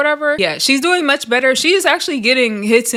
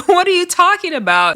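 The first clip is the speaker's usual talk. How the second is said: exasperated disbelief; 8 semitones higher than usual. Real, not acted. Exasperated reaction to a flip-flop.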